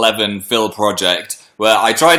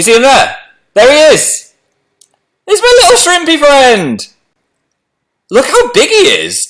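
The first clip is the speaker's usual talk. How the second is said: much higher and louder, thrilled; delighted squeal; 12 semitones higher, 5 dB louder than usual. Real, not acted. Spotting her shrimp on camera, excited high squeal. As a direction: squeal with joy at finding him. Finally spots the pet shrimp.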